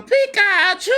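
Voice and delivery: high squeaky Pikachu cry. Retold or imitated. Kid imitating Pikachu's high cry at the game. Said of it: "Pikachu starts going".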